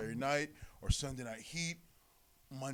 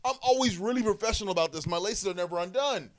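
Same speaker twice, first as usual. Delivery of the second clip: worked-up insistence; 8 semitones higher, 5 dB louder than usual. Retold or imitated.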